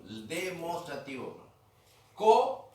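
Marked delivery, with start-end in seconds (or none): 2.1-2.7 Co called out high and loud; teacher's model word. Shown as a demonstration. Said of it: "como está ahí". (palabra modelo Co, dicha fuerte y aguda)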